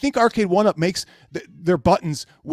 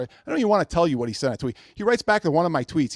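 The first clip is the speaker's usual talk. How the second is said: ordinary delivery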